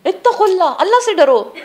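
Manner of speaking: high-pitched, urgent preacher's warning; impassioned exhortation. Real, not acted. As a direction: cry it out as a warning, pitched well up. Urges listeners to fear Allah.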